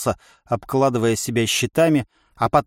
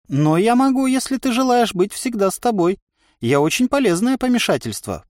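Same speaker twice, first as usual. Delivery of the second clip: higher-pitched, eager voice in the head; wheedling inner voice; 10 semitones higher than usual. A narrator's reading. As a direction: offer your company coaxingly, pitched up. (вкрадчивый внутренний голос, повыше)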